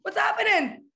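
high, loud frantic calling out; frantic searching desire. Pretend. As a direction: shout like you're lost looking for someone.

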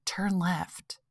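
hushed whisper, barely voiced; soft intuitive voice. Inner voice nudging her to turn left, whispered. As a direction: whisper it like a gentle nudge. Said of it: "the other one that's like".

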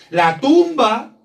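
천천히 힘주어 선포; slow emphatic proclamation. Acted. Preacher dramatizes the empty tomb.

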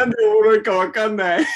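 笑いながら. Real, not acted. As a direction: crack up mid-sentence. ツボに入って笑う. (笑いをこらえきれずに)

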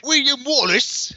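high-pitched, floundering Scottish accent. Shown as a demonstration. Host put on the spot by co-host, hopeless mock Scottish accent. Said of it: "in a, uh, Scottish accent".

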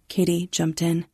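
usual speaking voice

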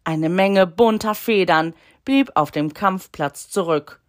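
ordinary delivery

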